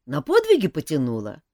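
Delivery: шут Жак. A narrator's reading. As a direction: needle the prince a little. Жак расспрашивает принца. (насмешливый шут, повыше и громче)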